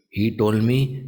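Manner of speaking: normal talking voice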